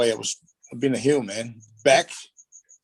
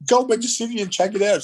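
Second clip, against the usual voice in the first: imitating wife; 10 semitones higher, 4 dB louder than usual. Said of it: "She goes".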